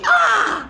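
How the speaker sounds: high shouted ah of shock; a sudden high shocked ah; mock shout. Retelling the friend's reaction, a high shocked ah. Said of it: "Il a dit".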